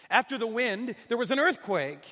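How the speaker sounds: preacher climbing toward the quake; dramatic build. A narrator's reading. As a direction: push it up like the ground shaking. Narrating the earthquake.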